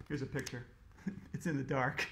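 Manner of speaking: ordinary delivery, nothing marked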